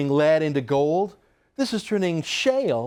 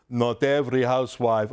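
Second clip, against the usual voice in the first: mock Russian accent on the quote; a light put-on Russian accent; 5 semitones lower than usual. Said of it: "I won't read it in a Russian accent".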